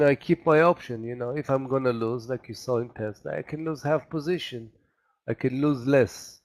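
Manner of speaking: ordinary delivery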